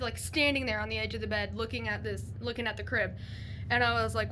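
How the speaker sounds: usual speaking voice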